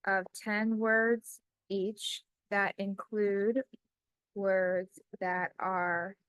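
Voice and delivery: slow, word by word, as if typing along; measured dictating voice with long pauses; slow dictation. Acted. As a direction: spell out each phrase for the computer. Dictating a prompt while typing.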